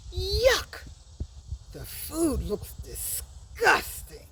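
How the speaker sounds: high yuck, then slow, disgusted words. Shown as a demonstration. Teacher shows the class disgust at food, slowly. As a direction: sound grossed out by the food. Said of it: "you must say that word with emotion".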